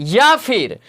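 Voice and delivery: normal talking voice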